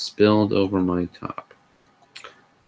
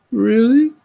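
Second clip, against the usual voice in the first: high, louder whimpering Really; whimpered; 6 dB louder than usual. Acted. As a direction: whine Really up high like a hurt puppy. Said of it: "he whimpered".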